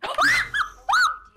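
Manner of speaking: sudden high gasp of shock; sharp loud gasp. Real, not acted. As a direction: gasp as an enemy appears right in front. Enemy suddenly right in front.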